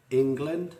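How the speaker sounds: ENG-land said crisply, ready to be turned into Māori; England, clear and deliberate, for the class to repeat; England said first. Shown as a demonstration. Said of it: "I get you to say England first".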